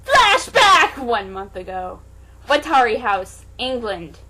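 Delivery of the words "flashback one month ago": dramatic announcement. Acted. Repeated flashback announcement.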